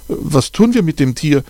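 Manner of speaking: put-on high, eager question from a young girl; a lightly voiced excited girl; excited child. A narrator's reading. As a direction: ask it all worked up, voice jumping high. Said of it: "fragt Hanna aufgeregt".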